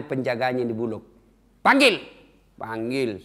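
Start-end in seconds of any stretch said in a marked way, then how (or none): 1.6-2.0 sharp high shout to summon the guard; shouted order. Retold or imitated. Umar shouting for the guard to be called. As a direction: bark the order loud.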